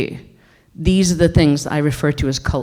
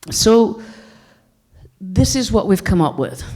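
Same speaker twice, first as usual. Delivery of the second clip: usual speaking voice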